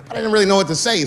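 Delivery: ordinary talk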